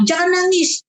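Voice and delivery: the fussy way not to say it; wrong example. Shown as a demonstration. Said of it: "jangan bilang".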